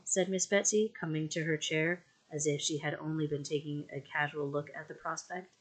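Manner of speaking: plain reading voice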